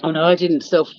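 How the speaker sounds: ordinary delivery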